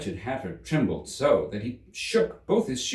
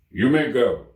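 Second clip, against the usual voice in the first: much louder dismissal; curt King; 3 semitones higher, 8 dB louder than usual. Acted. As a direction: wave him off.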